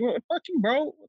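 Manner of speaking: hammed-up villain snapping back at Spider-Man; mock angry villain. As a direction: squeal it like an offended teen. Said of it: "he's like".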